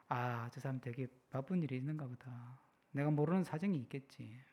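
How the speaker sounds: low, soft, understanding murmur; calm forbearance. Acted. Driver excusing a rude driver, calm and soft. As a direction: let it go quietly, giving the benefit of doubt. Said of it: "지금은 조금 성숙해져가지고".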